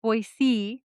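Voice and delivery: Boise, careful s sound; corrected Boise. Shown as a demonstration. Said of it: "I mispronounced that".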